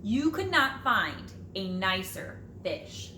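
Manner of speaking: plain reading voice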